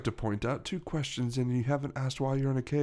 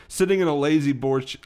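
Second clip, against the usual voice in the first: usual speaking voice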